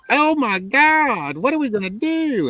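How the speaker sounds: high, very slow, loud mock panic. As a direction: wail it like a terrified civilian. Panicked civilians, China invading.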